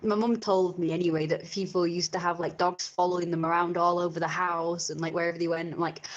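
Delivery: ordinary talk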